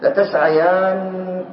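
each syllable of لتسعيانن stretched; very slow spelling-out. Shown as a demonstration. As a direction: build لتسعيانن slowly. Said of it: "ما الذي حصل الآن؟". (تهجئة بطيئة جدًا)

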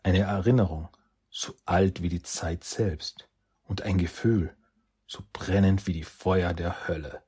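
plain reading voice

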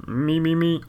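high, held little warm-up notes; mock singer warming up the voice high; vocal warm-up. Warming up before an impression.

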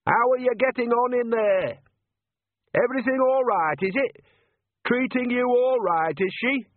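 shouting to someone inside her head. Acted. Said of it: "raised her voice".

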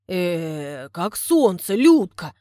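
loud flustered stammering; a flustered suitor hunting for compliments, loud; fumbling for words. Acted. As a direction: scramble for the next compliment. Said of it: "Судорожно подбирает эпитеты".

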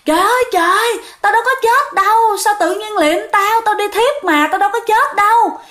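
imitating a woman wailing that she is not dead; mock wailing shout. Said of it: "cứ la".

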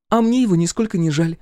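put-on high, indignant girl's voice; exclaiming. A narrator's reading. Girl refuses to pity the villain, exclaiming. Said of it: "воскликнула Олли".

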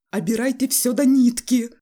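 high, very loud squealing yell; shrill shouting. A narrator's reading. Father running about the room accusing family, shrill shout. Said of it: "кричал он визгливым голосом".

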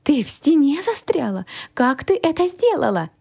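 high-pitched amazed questioning; astonished guardian. A narrator's reading. Startled guardian questions the heroine.

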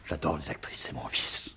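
breathy whispered aside, as if telling a secret; whispered confession. Acted. Rhetoric professor whispering his secret vice, confiding. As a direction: let it slip out in a whisper.

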